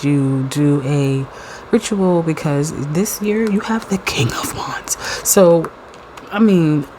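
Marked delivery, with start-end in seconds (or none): 2.2-5.0 soft unvoiced whisper; hushed secret reveal of the card. Real, not acted. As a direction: confide it in a whisper. Conspiratorial reveal to viewers.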